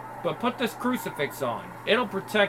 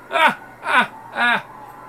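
mocking cackle; 5 semitones higher, much slower than usual. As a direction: cackle to ridicule the old man. Mocking laugh at priest.

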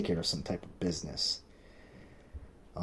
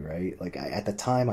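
usual speaking voice

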